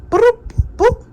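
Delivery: high playful sound effect; playful boop. Real, not acted. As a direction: toss off a cartoonish boop. Playful sound while finishing.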